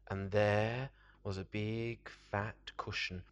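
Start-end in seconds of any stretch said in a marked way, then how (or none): none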